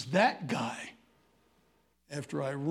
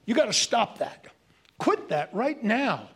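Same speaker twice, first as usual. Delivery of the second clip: loud finger-wagging scold; bossy scolding; 5 semitones higher, 6 dB louder than usual. Retold or imitated. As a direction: lay down the law to everyone else. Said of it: "We tell everybody else".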